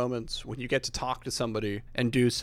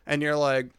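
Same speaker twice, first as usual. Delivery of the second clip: ordinary delivery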